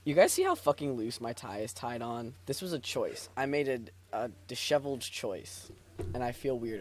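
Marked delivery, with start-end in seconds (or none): none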